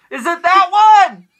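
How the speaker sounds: high, loud call from downstairs; yelling across the room. Retold or imitated. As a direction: call up through the house. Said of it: "and you're yelling like".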